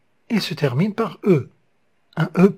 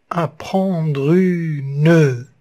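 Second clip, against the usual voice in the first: very slow, each syllable spelled out; slow scansion of the verse; counting syllables; much slower than usual. Shown as a demonstration. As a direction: stretch the line syllable by syllable. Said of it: "Ici, il y a une consonne après le P".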